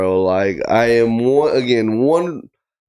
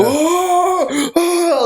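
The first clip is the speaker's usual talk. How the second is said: high imitated Punisher screams; mock scream. Copying how the actor screams, high yelp.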